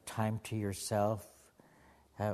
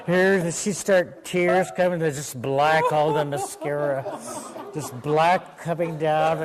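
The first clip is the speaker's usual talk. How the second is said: loud, telling it through laughter; laughing storyteller; 4 semitones higher, 8 dB louder than usual. Real, not acted. Laughing at her own story.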